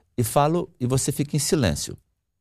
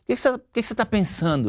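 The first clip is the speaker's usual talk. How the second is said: higher, quick insistent questioning; irritated wife; 10 semitones higher, faster than usual. Retold or imitated. Wife asks husband his thoughts, mildly irritated. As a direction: imitate a wife probing impatiently. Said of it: "a esposa se irrita".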